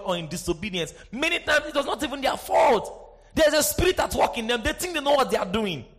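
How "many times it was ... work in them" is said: impassioned preaching over music. Acted. Preacher stirring the congregation. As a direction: preach it out with fire.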